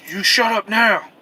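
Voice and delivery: slow, loud barked order; sergeant yelling. Retold or imitated. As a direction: bark it down at him like a sergeant. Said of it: "he, he called me up".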